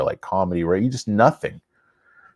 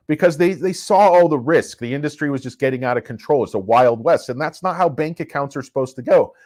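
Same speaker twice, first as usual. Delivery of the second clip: loud, high, worked-up rant; getting carried away, louder and higher; shouting; 9 semitones higher, 4 dB louder than usual. Real, not acted. Ranting to viewers about fintech middlemen, loud and high. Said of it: "I gotta stop shouting again".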